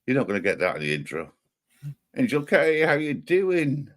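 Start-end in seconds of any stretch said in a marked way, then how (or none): 2.2-3.9 high-pitched, drawn-out hello; singled-out welcome, pitched way up; calling out a greeting. Real, not acted. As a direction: greet an arriving viewer high and stretched. Calling a greeting to arriving viewer.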